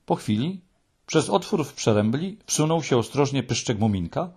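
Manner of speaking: plain reading voice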